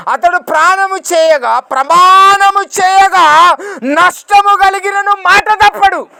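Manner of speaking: loud, high, ringing proclamation of scripture; preaching at full force. Acted. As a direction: cry the verse out to the congregation. (గట్టిగా ప్రసంగ స్వరం)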